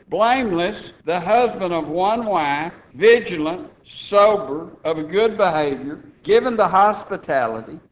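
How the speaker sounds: punchy, emphatic pulpit delivery; forceful preaching. Real, not acted. Preacher telling the church who may be bishop, forceful preaching.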